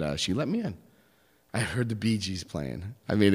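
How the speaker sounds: usual speaking voice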